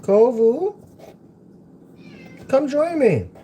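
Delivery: high, loud call to a pet; calling the cat. Real, not acted. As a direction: sing out to call the cat over.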